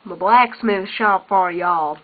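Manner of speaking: slow, drawled Southern American twang; playful Southern American drawl, lightly done; Southern American accent. Pretend. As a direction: put on a folksy Southern American accent to show off the shop. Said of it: "I was speaking in a Southern American accent".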